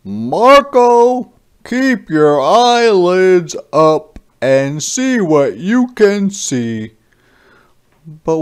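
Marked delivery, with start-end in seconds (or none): 0.0-6.9 higher, louder fatherly voice; dad advising his son Marco, in a higher, louder voice; Dad's advice. A narrator's reading. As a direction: speak up as the dad giving advice. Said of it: "Dad always says to me".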